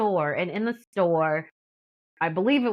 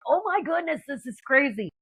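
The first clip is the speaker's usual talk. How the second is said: high-pitched retold amazement; mock inner freak-out, pitch jumping up; inwardly freaking out; 7 semitones higher than usual. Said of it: "spazzing out".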